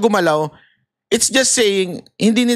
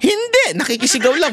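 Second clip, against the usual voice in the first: high then plain, casual answer; bystander reply; 7 semitones higher than usual. Pretend. Bystander explaining he is only joining the screaming, casual. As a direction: shrug it off.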